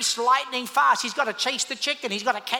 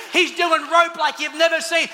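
ordinary talk, nothing marked

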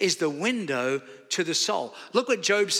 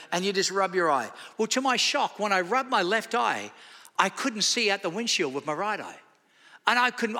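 usual speaking voice

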